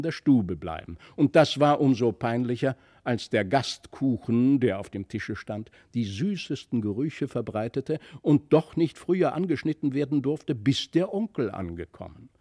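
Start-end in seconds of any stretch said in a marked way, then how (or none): none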